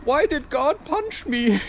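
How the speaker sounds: high-pitched mock yelp turning to a whine; pained protest. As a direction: wail it like someone just hit. Acts out being punched, protesting.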